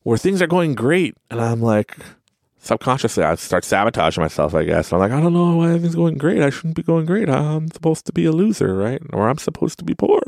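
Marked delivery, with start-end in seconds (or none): 5.1-10.2 mock self-pity. Self-sabotaging inner voice quoted.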